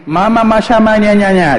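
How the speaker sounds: put-on high-pitched baby babble; imitating a baby's first talk; babbling baby. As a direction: babble nonsense syllables like an infant. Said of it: "lo escuchas al niño".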